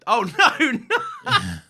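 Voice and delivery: high, loud laughing protest. Real, not acted. Co-host laughing at the mix-up.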